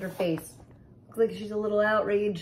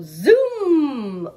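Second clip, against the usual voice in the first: big swooshing zoom; zoom sound; 6 semitones higher, 8 dB louder than usual. A narrator's reading. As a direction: sweep the word out like a car speeding off. Sound effect word read aloud.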